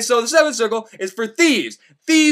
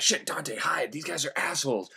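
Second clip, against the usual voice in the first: hushed warning; 5 semitones lower, 12 dB quieter than usual. Retold or imitated. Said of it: "Virgil's like".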